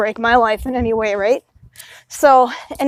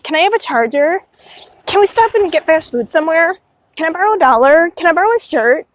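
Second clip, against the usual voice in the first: imitating a constant asker, pitched higher; mock needy requests in a higher voice; needy pleading; 5 semitones higher than usual. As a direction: pester like a needy friend, lightly mocked. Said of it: "they're just so needy".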